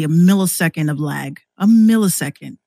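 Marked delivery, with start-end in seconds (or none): none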